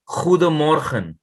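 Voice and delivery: "goedemorgen" said slowly with throaty G sounds; Dutch pronunciation. Shown as a demonstration. Teacher showing a student Dutch greeting, slow throaty demo. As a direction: drag out "goedemorgen", the G scraped from the throat like kh. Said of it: "So, say".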